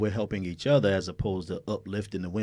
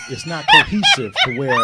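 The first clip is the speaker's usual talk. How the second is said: laughing; 10 dB louder than usual. Real, not acted. Guest laughs mid-answer.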